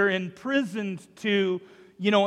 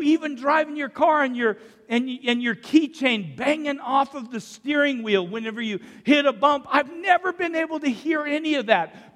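higher, animated voicing of a man's wonder; retold amazement; 6 semitones higher, 4 dB louder than usual. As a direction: let the retold wonder lift the pitch. Retelling man's amazement at hearing.

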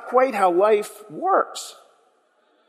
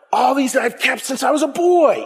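boastful ruler; 7 semitones higher than usual. Acted. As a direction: brag like he aced it.

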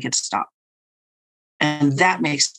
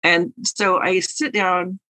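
ordinary talk

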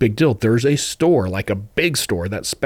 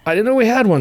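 surprised; 12 semitones higher, 5 dB louder, faster than usual. Retold or imitated. Own surprised reply, pitched up.

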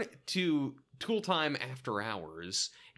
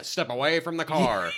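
robotic car alarm voice; 3 semitones higher, 6 dB louder than usual. Retold or imitated. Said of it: "those talking car alarms from the nineties".

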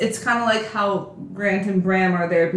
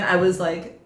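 ordinary talk, nothing marked

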